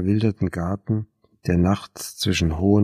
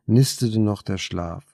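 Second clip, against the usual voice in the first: usual speaking voice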